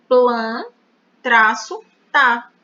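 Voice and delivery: loud, split Plan and tá apart; syllable split. Shown as a demonstration. Teacher splits planta for the class, loud and deliberate. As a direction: say Plan, pause, then tá, loudly. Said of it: "vai ficar como?".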